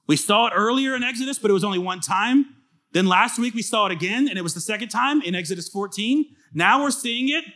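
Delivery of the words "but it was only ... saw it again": normal talking voice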